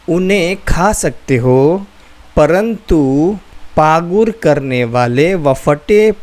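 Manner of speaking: slow, deliberate scripture reading, word by word; slow careful reading. Shown as a demonstration. Teacher showing viewers how to read verses slowly. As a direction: read it slowly, letting each phrase sink in. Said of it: "एकदम आस्ते आस्ते पढ़ो".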